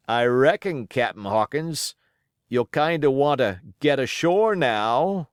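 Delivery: put-on wounded old pirate, coaxing and sly. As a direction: wheedle the boy toward a deal. Pirate Hands speaks slyly.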